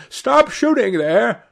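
high, urgent call. A narrator's reading. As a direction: call a halt across the field, pitched high. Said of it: "called out at the top of his voice".

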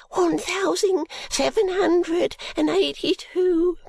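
put-on high, dazed servant-girl murmur; high, timid maid voice. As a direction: mumble the number as if in a trance. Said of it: "Guster reiterates".

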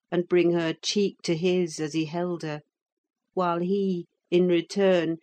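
ordinary talk, nothing marked

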